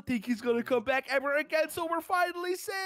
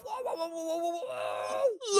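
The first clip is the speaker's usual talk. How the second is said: scared whoa; 2 semitones higher, 4 dB louder than usual. Acted. Scared by monster on roof.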